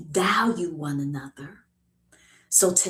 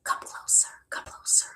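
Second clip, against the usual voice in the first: soft, unvoiced whisper; hushed invitation; 4 dB quieter, faster than usual. Real, not acted. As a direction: whisper it to draw the listener in. Draws listeners in close.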